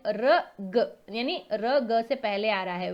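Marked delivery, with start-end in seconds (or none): 0.1-1.0 very slow spelling out. Shown as a demonstration. Teacher breaking वर्ग into sounds, very slowly. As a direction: drag र and ग apart, very slowly. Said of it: "व complete है".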